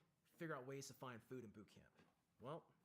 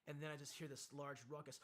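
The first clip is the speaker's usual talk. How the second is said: ordinary talk, nothing marked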